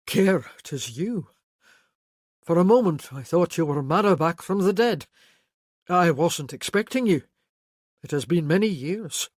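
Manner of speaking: a hint of a stunned uncle; shaken uncle. Voices Doulis, a shaken uncle.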